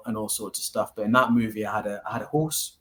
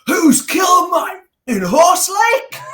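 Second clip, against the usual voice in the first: much higher, loud, slow Geordie shout with a cry in it; strongest Geordie accent; 13 dB louder, much slower than usual. Retold or imitated. As a Russian boxer on the film set, yelling in thick Geordie. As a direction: bellow it in broad Geordie, dragging every word. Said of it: "my strongest Geordie accent".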